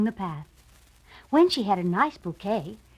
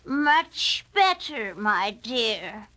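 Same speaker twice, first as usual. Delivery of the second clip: wolf hiding in a granny voice; much slower than usual. A narrator's reading. Wolf answering the girl in disguise. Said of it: "said the wolf".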